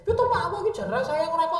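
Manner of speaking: wife protesting to her husband, whiny. Acted. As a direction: complain in a rush that he doesn't care.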